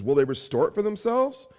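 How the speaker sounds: mocking question. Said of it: "a mocking rhetorical question".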